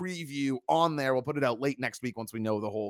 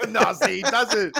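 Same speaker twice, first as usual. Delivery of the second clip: laughing through the words, higher and louder; 9 semitones higher, 7 dB louder, slower than usual. Real, not acted. Host cracking up at the impression, talking while laughing. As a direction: laugh out the protest.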